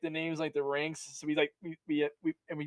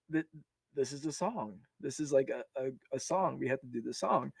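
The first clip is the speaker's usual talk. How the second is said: quiet, under the breath; muttered quote of himself; hushed aside; 3 semitones lower, 10 dB quieter than usual. Retold or imitated. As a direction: say it low and confidential.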